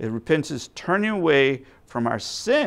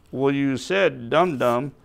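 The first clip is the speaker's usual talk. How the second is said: normal talking voice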